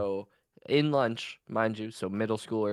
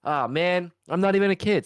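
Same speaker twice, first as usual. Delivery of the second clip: mock regret; 9 semitones higher, 7 dB louder than usual. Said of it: "I go".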